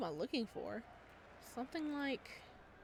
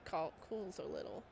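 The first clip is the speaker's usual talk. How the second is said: ordinary delivery, nothing marked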